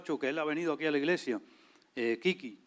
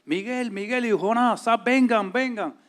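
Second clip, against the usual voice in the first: higher, louder, slow urgent yelling of names; shouting for help; 6 semitones higher, 6 dB louder, slower than usual. Retold or imitated. Calling friends in the room upstairs after a fight, loud high shout. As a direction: call them down from upstairs, urgently. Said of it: "Y gritaba a".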